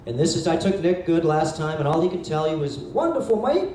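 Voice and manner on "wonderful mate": high-pitched imitation of an Australian; attempted Australian accent. Speaker quoting his Australian acquaintance to the group, attempted Australian accent. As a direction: put on a rough go at an Australian accent. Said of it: "I can't do the Australian accent".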